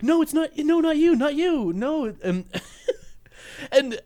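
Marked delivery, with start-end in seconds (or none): 0.0-2.1 high frantic no not you; panicked protest. Retold or imitated. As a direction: plead in mock panic. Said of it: "he's like".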